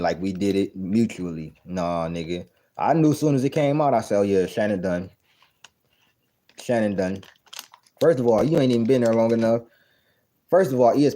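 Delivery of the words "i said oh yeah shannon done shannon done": normal talking voice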